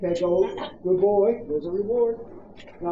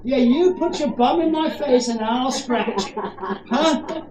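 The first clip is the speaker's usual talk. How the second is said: laughing to dog; 5 semitones higher, 6 dB louder, much slower than usual. Real, not acted. Playful talk to dog.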